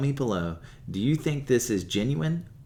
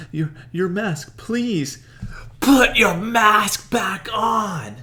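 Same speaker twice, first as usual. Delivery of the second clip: much higher, louder, slow shout; disgusted demand; 12 semitones higher, 9 dB louder, much slower than usual. Retold or imitated. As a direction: yell it in horror. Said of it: "She says".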